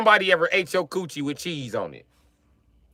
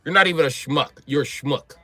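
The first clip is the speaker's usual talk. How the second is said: mock Italian accent, exasperated scolding; angry Italian. Comic's Italian scolds the lasagna maker in mock Italian accent. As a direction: play the offended Italian berating the cook. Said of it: "Italians be mad about it".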